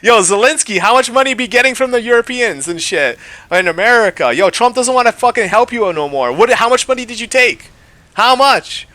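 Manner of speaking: high, loud, jeering demand; loud taunt. Real, not acted. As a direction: needle him for the money. Taunting Zelensky directly.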